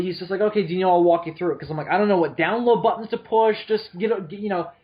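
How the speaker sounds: normal talking voice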